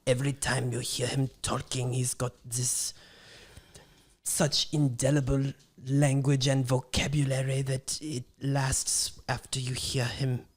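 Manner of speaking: put-on a hushed, solemn German accent; Werner Herzog German accent. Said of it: "that was a pretty good accent".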